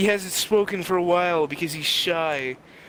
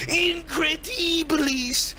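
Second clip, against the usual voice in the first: high, drawn-out mock yell; mock scream; 10 semitones higher, slower than usual. Retelling the man kicking the door open, high mock scream. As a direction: stretch it out high like a spell cry. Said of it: "and he screams".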